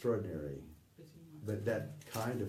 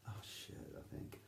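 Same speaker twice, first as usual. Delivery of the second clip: under-the-breath thought; 13 dB quieter than usual. Retold or imitated. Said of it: "thought to himself".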